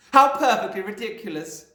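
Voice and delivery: high, loud scoffing headmistress; snorting scorn. A narrator's reading. Trunchbull scoffing at the song, snorting. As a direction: scoff it with a laugh. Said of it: "snorted the Trunchbull".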